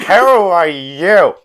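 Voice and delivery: drawn-out, high, loud bellow; shouted challenge. Acted. Drunken player character confronting a fancy gnoll, loud shouted challenge. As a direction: bellow it slowly as a demand.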